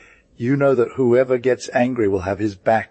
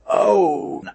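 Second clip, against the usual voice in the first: distressed cry; 10 semitones higher than usual. Acted. Master groans in distress.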